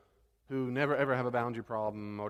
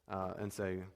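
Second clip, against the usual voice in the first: normal talking voice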